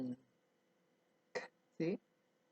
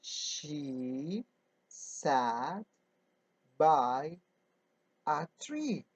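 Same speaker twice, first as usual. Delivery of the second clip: slow word-by-word reading; much slower than usual. Shown as a demonstration. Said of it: "Now we say".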